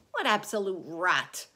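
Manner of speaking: loud, scornful dismissal; scoffing. A narrator's reading. Centipede scoffing at the earthworm, bravado. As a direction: brush it off with bravado. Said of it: "his voice seemed to have become a little shaky".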